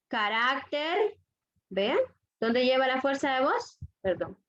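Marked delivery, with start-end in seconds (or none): 0.1-1.2 "carácter" slowly, weight on "rác"; stress on penultimate syllable. Shown as a demonstration. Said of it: "En la penúltima sílaba".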